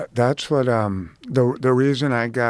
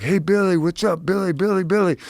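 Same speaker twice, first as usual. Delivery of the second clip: fans calling out; 6 semitones higher than usual. Retold or imitated.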